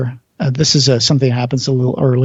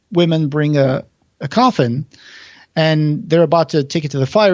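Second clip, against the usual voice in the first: normal talking voice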